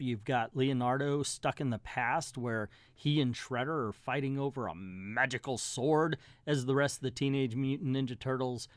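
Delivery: usual speaking voice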